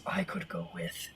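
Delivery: soft, hesitant whispered offer. Acted. Oscar whispering his offer to tag along.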